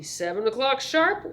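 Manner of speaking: disbelieving repeat; incredulous echo. A narrator's reading. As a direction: throw the time back as a question. He echoes her in disbelief.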